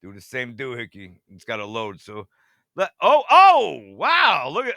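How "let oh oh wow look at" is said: loud, startled oh-oh-wow; sudden awed exclamation, slowly drawn; real surprise. Real, not acted. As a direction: gasp it out as the radar finally loads. First sight of southern radar.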